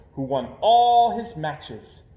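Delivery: ordinary delivery, nothing marked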